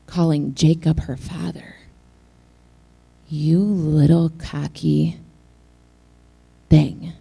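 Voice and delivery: usual speaking voice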